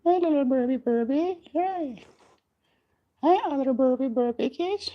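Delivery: put-on high soft baby-talk greeting; hushed squeaky cooing at baby birds. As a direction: coo softly and high to the chicks. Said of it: "I wanna just, like, be".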